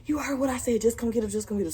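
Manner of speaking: imitating a pushy family member; mock bossy relative. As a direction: snap it like a relative giving orders. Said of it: "Then she like".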